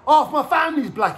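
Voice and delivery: high, loud plea to the judge; acted protest.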